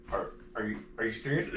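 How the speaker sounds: low, soft nervous mumble; imitating him. Imitating the awkward guy on the date, soft mumble. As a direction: mumble it, caught off guard. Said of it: "he goes".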